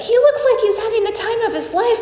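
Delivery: bright laughing rush, pitched high and a bit fast; giggling quote. Retold or imitated. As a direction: laugh through it with delight. Mom sees son on bus.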